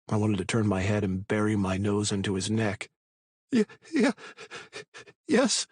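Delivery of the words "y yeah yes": high, shaky frightened voice; scared stammer. A narrator's reading. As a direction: stammer it out in fear.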